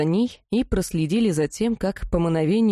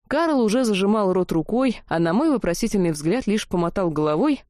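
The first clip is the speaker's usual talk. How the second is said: ordinary delivery, nothing marked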